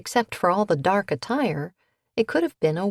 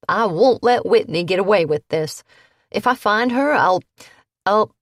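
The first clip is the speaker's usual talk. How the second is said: louder, higher angry threat; angry vow; 4 semitones higher, 6 dB louder than usual. A narrator's reading. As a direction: choke on fury, louder. Angry grandson vowing.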